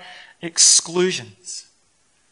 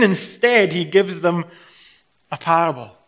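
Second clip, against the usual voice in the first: ordinary delivery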